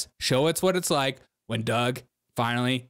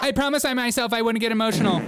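mock speech parody; 7 semitones higher, faster than usual. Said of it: "that's me saying I'm gonna get emotional".

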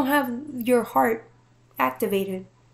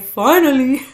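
relieved cheer; 3 semitones higher, 11 dB louder than usual. Retold or imitated. Guides relieved in background.

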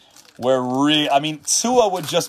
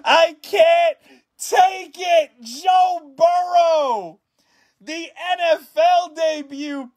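high-pitched, stretched-out shrieks of disbelief; ecstatic screaming; 13 semitones higher, slower than usual. Real, not acted. Streamer shrieking at pulling a rare card live. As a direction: scream it like you can't believe the pull. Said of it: "I'm losing my voice".

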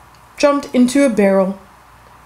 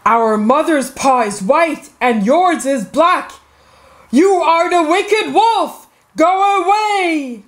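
accusing cry building higher to "Go away"; 6 semitones higher than usual. A narrator's reading. Seven kids sending the wicked wolf away, crying out. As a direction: start firm, rise to a high cry. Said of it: "they cried".

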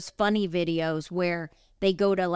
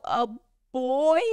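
shocked disbelief; 3 semitones higher than usual. Retold or imitated. Reacting to hearing it's a boy.

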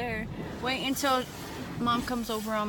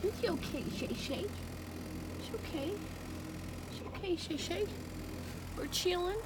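soft, higher comfort for the dog; gently calm a frightened pup; soothing; 8 semitones higher, 8 dB quieter than usual. Real, not acted. Owner soothing the scared dog Shay Shay, soft and high.

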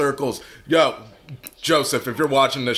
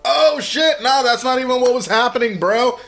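normal talking voice

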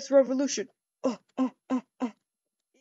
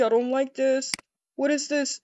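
ordinary delivery

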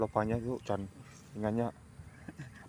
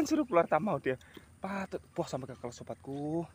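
ordinary talk, nothing marked

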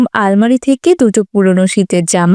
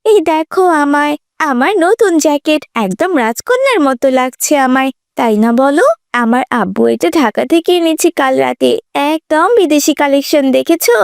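put-on higher, showing-off girl's voice; boastful schoolgirl; 5 semitones higher than usual. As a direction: show off a new jacket to classmates. (গর্বিত স্কুলছাত্রীর গলা)

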